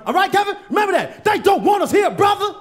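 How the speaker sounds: yelling the warning at Kevin; shouting. Acted. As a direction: build it to a full shout. Final repeat, shouting it out.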